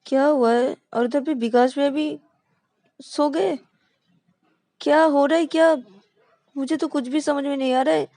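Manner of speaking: can't make sense of it, exasperated; baffled. Acted. Ravi baffled by both crying. (उलझन में ऊँचे सुर में)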